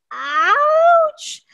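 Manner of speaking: high, drawn-out cry of pain; loud storyteller's "ouch" scream. A narrator's reading. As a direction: yelp it loud and long, rising sharply. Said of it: "He screamed".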